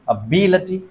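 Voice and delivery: "Ability" with बि stressed; stress demo. Shown as a demonstration. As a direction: lean on बि in "ability", short लटी after it. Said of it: "ये लटी एक हो गया और उसके पहले". ("Ability" में बि पर ज़ोर)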